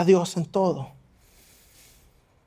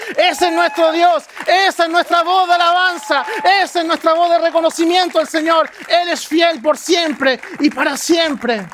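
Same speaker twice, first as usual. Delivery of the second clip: fiery preacher's call; preaching at full force; 8 semitones higher, 4 dB louder than usual. Acted. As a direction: proclaim it over the congregation. Preacher proclaiming over music.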